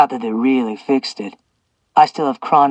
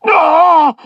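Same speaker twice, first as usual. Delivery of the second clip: sharp high loud cry of pain; sudden shocked scream; 8 dB louder than usual. A narrator's reading. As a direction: yelp as the shock hits. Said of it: "Zeus groaned".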